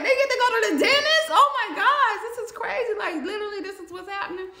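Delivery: high, laughing, breathless wonder; giddy disbelief at the perks; amazed excitement. Retold or imitated. New inmate marveling to herself, high giddy amazement.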